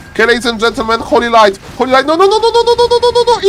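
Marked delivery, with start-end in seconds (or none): 2.1-3.5 very high, rapid string of alarm; frantic high squeal; panicked protest. Real, not acted. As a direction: beg it not to happen, pitch shooting up. Panic at a game moment.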